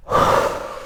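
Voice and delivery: long audible breath out through the mouth; demonstration exhale. Shown as a demonstration. Teacher showing students the breath out, audible unvoiced exhale. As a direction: push all the air out for them to hear. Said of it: "breathe out to start".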